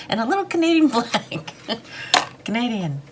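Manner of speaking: giggling while pointing out the tiny flag; laughing. Real, not acted. Viewer hears her laugh at the little Canadian flag.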